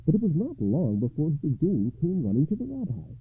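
plain reading voice

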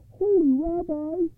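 high-pitched distraught poor man; wailed plea, very high voice; crying out. A narrator's reading. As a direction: cry out high and drawn out in despair. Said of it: "he cried".

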